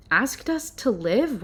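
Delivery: slow, stunned disbelief; incredulous. Real, not acted. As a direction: repeat it as if you can't believe it. Repeats the phrase in disbelief.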